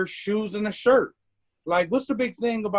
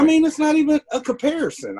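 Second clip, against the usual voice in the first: much higher and louder, fed up; heated indignation; 10 semitones higher, 5 dB louder, faster than usual. Real, not acted. Dismissing the comparison to the hosts, high and loud with indignation. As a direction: let it burst out, almost shouting. Indignant rant about police double standards.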